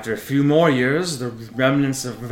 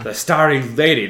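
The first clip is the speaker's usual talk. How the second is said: usual speaking voice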